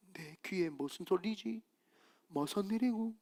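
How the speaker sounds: imitated God murmuring to himself; God puzzled. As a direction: wonder quietly what that noise is. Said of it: "그 하나님이".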